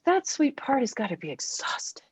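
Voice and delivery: whispered softly, with tenderness; soft tender whisper. Real, not acted. Tender aside about exhausted part.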